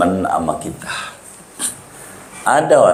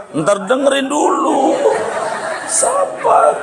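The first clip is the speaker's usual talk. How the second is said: very high squeal for patience; high-pitched protest. Real, not acted. Preacher telling an eager crowd to listen first, high protest. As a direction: rein them in, voice shooting right up.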